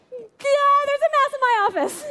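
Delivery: high, fast, laughing yell about the mouse; panicked yell. Retold or imitated. Pastor retelling calling out to empty hall, a high laughing yell. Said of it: "I literally yelled it".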